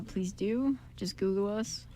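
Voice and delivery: usual speaking voice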